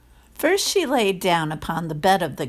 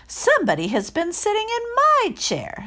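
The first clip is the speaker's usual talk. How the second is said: higher, middling bear voice; lightly voiced mother bear; middle-sized bear. A narrator's reading. Middle bear sees her cushion crumpled, mild middle voice. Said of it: "in her middle voice".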